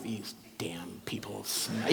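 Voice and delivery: slow, soft unvoiced whisper; grumbling whisper. Irritated traveller muttering in the airport, slow whisper. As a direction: whisper it slowly, fed up. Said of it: "it would be like".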